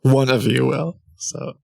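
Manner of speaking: normal talking voice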